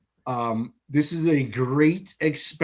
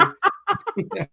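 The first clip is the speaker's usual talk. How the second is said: high burst of laughter. Real, not acted. Laughs at his own insult.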